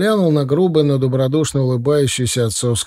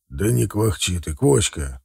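lower, a hint of a stern father; 4 semitones lower than usual. A narrator's reading. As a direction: brush off his fussing wife, lower. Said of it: "сказал отец строго". (строгий отец, чуть ниже)

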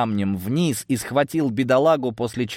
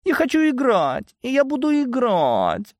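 very high, petulant whine; childish tantrum. A narrator's reading. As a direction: demand it like a spoilt child. Pouting woman demands to play. (капризный высокий детский вой)

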